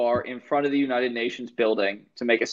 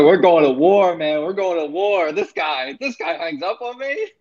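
high, loud mock war cry; hammed-up furious rant, pitched way up; imitating Tony screaming; 5 semitones higher, 4 dB louder than usual. Tony screams at the phone after the hang-up, mock rage. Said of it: "screaming at the speaker".

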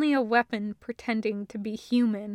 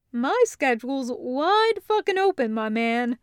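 put-on swagger it like an old army pal; Taco, breezy; 3 semitones higher, 4 dB louder than usual. Taco joking to Hallwinter, brash and breezy. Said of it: "he says".

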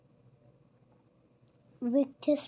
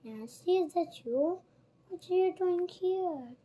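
surprised; 6 semitones higher than usual. Acted. Oliver surprised to see Nancy.

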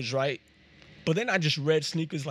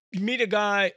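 ordinary delivery

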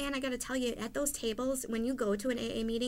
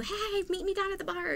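breezy friend's invitation; 9 semitones higher than usual. Retold or imitated. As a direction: imitate a friend calling you out. Imitating friends calling with invitation.

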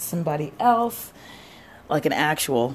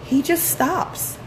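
very high, drawn-out disbelief, louder; incredulous; 4 dB louder, slower than usual. Real, not acted. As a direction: stretch it out in outraged amazement. Disbelief at the student quitting.

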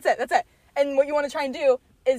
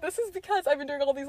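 ordinary talk, nothing marked

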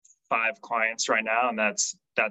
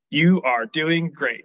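slow, higher, louder robot monotone; robotic voice; 5 semitones higher, 6 dB louder, slower than usual. Retold or imitated. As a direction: read it like a stiff machine. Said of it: "read it in a very robotic voice".

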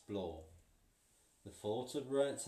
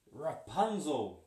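witch calling out; 5 semitones higher, 7 dB louder, slower than usual. Acted.

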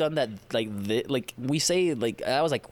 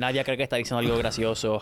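fast; 2 semitones lower, faster than usual. Pretend. Dictating mock subtitle, rushed.

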